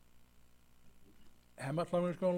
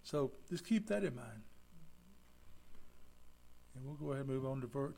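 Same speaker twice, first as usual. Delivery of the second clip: usual speaking voice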